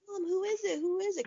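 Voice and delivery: put-on high, urgent child's voice; excited daughter. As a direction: pester like an eager kid. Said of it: "she's like".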